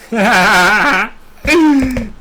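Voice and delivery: big high gloating laugh; triumphant cackle; loud laugh. Real, not acted. Gloating over banned troll.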